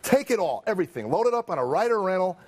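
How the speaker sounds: high-pitched rant, laughing through it; retold angry outburst. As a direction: hurl it at the auditor, half laughing. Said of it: "this is when I lost it".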